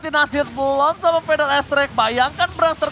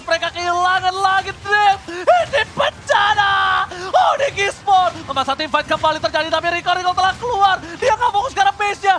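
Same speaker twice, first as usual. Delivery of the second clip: fighting game announcer; 6 semitones higher than usual. Real, not acted. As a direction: roar the wipeout to the arena. Caster calls the game-winning fight.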